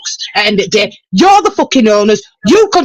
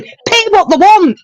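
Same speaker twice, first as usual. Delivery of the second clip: frustrated outburst; 8 semitones higher, slower than usual. Real, not acted. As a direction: pitch jumps high, exasperated pleading. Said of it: "I am so fucking frustrated".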